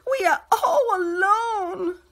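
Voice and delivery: high, loud, slow and tearful; crying. A narrator's reading. Gretel to Hansel, crying out high and loud. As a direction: wail it out, frightened and lost. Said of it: "Gretel cried".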